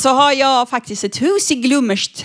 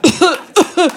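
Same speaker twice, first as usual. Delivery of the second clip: high, loud stunned stammering; baffled stammer; 4 semitones higher, 3 dB louder than usual. Retold or imitated. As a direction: stumble like the thug lost for words. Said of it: "Och han bara".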